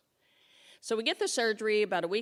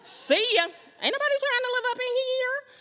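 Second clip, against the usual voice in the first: very high, louder sassy brush-off; sassy cadaver cells; 9 dB louder than usual. Retold or imitated. The dead cells leaving her chest, a high, loud, sassy goodbye. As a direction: wave them off with sass, climbing higher. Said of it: "they was like".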